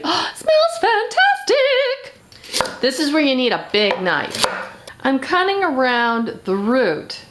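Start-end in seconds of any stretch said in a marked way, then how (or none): 0.0-2.1 delighted. Real, not acted. Smelling fennel while cooking.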